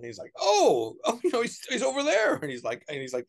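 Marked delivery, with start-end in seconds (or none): 0.4-2.3 much higher, excited put-on voice; mock awed tout. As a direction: gasp the name like it's a celebrity. Tout points to the office.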